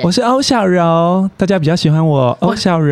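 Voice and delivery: lightly voiced girlish persona; a hint of a cute girl voice; female character voice. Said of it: "我的女性角色".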